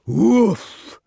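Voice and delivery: loud imitated "woof". A narrator's reading. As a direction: imitate a heavy crash as a woof. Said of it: "cried the door".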